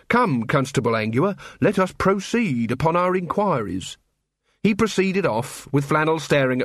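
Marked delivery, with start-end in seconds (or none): none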